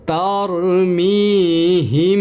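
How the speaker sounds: "তার মি হিম" with nasal hum held on মিম; nasal ghunnah on "হিম", high and slow. Shown as a demonstration. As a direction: hum the meem through the nose before বা. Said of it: "এখানে গুণনা করব এইভাবে".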